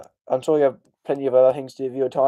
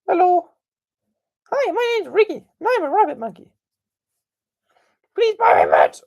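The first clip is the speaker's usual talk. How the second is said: much higher cartoon plush toy voice; Riggy plush voice; 4 dB louder than usual. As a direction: squeak it up like a talking plush toy. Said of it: "here's a Riggy Plus".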